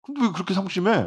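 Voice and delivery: high, puzzled question from the friend. Acted. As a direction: ask it high and baffled, as if this is good news. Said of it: "라고 질문했죠".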